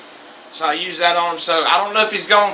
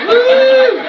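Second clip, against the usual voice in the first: high, loud celebratory whoop; real excited whoop; 8 dB louder than usual. Real, not acted. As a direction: let out a big whoop. Whoop after laughing.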